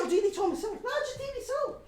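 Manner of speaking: squeaky high mock whine. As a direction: parrot his excuses in a silly high voice. Mocking the absent mate's excuses.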